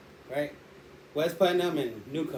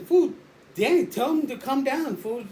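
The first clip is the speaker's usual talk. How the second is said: raised, high-pitched urgent plea; urgent appeal; 8 semitones higher, 5 dB louder than usual. Retold or imitated. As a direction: call out to the driver to step in. Urging driver during a fight.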